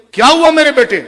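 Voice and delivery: high, loud cry of a frightened mother; a mother's pained, loud cry of worry; anguished mother. Retold or imitated. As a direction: call out in alarm for her son. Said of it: "تڑپ گئی".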